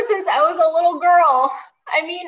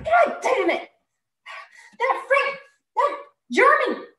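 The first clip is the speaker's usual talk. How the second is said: ordinary delivery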